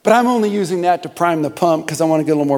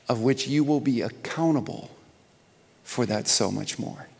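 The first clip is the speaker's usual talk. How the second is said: solemn and quiet; 4 semitones lower, 7 dB quieter than usual. Acted. Solemn warning about accountability.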